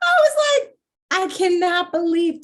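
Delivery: laughing hard, voice breaking up high. Real, not acted. Telling the podcast hosts about the flub, breaking into laughter. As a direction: crack up while telling it.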